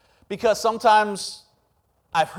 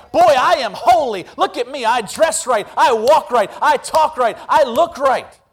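loud proud bragging, louder; smug self-righteous boast; 3 semitones higher, 4 dB louder than usual. Retold or imitated. Mocking churchgoers who boast of their holiness, smug and loud. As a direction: puff yourself up, smugly holy.

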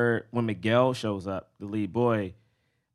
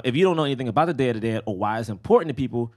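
normal talking voice

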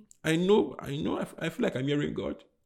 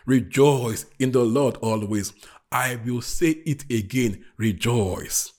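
slow, emphatic, lower-pitched Bible reading; scripture proclamation; 3 semitones lower, 5 dB louder, slower than usual. Real, not acted. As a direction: read it out deliberately to the congregation. Preacher reads scripture aloud.

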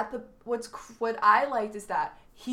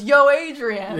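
loud Rocky "Yo, Adrian"; Rocky shout; 5 semitones higher, 12 dB louder, slower than usual. Retold or imitated. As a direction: belt it out like Rocky. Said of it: "And he goes".

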